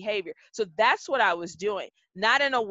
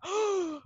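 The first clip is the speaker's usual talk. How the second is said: high, drawn-in gasp; imitated audience gasp; mock shocked gasp; 12 semitones higher, 3 dB quieter than usual. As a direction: gasp like a stunned onlooker. Acting out the shocked audience's reaction.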